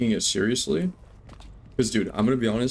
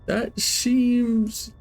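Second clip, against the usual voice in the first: suspicious; 9 semitones higher, much slower than usual. Real, not acted. Suspicious drawn-out aside.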